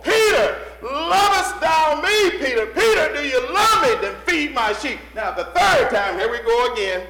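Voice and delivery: high, shouted pulpit appeal; full-force preaching. Real, not acted. Preacher calling Peter to lean on the Lord, shouting. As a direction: cry out to the congregation with great force. Preaches, cries out Jesus's question.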